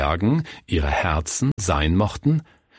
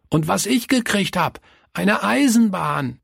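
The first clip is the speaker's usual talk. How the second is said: put-on excited child; 12 semitones higher than usual. A narrator's reading. Günther tells Willi about his new train, excited child. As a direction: boast happily in a high child's voice.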